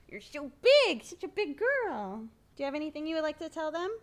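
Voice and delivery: high, doting parent voice. Real, not acted. Parent coaxing their little girl to greet viewers, baby talk. Parent talking to small child.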